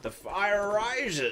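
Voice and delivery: slow Bane villain line. Acted. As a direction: drag it out like Bane. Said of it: "my old villain voice".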